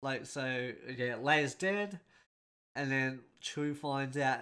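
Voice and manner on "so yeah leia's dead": ordinary delivery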